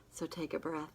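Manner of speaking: gentle whispered instruction. Real, not acted. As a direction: hush it, guiding a breath. Guiding the listener to breathe.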